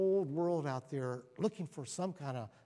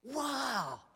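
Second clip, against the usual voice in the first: awed wow; 10 semitones higher than usual. Real, not acted. Marvelling at creation.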